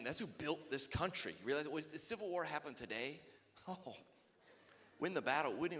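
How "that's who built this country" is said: ordinary delivery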